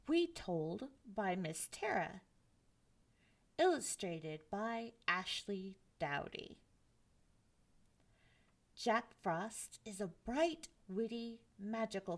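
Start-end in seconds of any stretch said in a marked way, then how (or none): none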